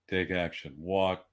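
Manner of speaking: ordinary talk, nothing marked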